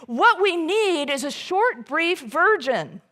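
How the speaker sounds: reliving a nervous blurt in the boardroom, loud and high. Retold or imitated. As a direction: blurt it out too loud from nerves. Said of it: "I said it kinda loudly and fast 'cause I was nervous".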